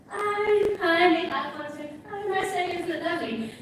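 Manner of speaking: high, sing-song chirpy hello; friendly greeting. Acted. Friendly walker greeting strangers on the road, high and sing-song. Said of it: "I'm a rather friendly person".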